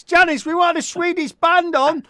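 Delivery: high, loud, hammed-up call to Janice; mock loud complaint. Host mock-complains to Janice about American guests, loud and high. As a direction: playfully shout the complaint across the room.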